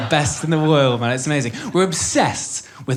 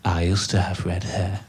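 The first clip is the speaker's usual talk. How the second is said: put-on much lower, quiet old woman; old lady voice; 9 semitones lower, 3 dB quieter than usual. Comic imitating an old lady in the supermarket, in a low quiet voice. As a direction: lightly voice a frail old lady. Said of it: "this little old lady comes up to us and she goes".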